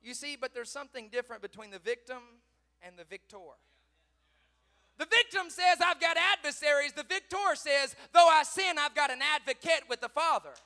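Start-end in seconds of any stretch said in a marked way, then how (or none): 5.0-10.6 high, loud sermon cry; full-force preaching. Acted. Preacher voicing the victim's complaint, full-force preaching. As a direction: pile up the list at full volume.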